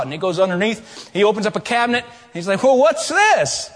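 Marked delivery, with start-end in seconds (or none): 2.6-3.7 high, loud, slow mock puzzlement; curious friend. Roger finds something under cabinet, high drawn-out puzzlement. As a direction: drag it out like a nosy inspector.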